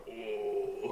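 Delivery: lightly imitated voice-actor groan; soft, drawn-out signature 'oh'; Travis. Fan imitating a voice actor's tell, softly put on. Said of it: "all of Travis's has".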